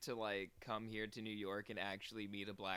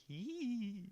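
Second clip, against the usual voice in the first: scared squeak; 10 semitones higher than usual. Retold or imitated. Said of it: "but also I'm like".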